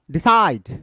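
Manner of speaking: slow "decide", weight on falling cide; stress on CIDE. Shown as a demonstration. Teacher checks the word for students, second syllable of "decide" stressed. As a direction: keep de light in "decide", lean on cide. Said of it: "unstressed और stressed है".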